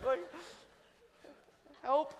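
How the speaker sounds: high frightened oh no; panicked. Retold or imitated. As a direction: freak out on the lift hill. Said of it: "I'm, like, freaking out".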